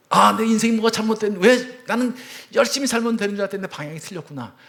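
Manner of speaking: loud, high cry of a man seeing his mistake; anguished realization. Retold or imitated. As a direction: let the realization burst out loud. Sudden realization of his wrong life.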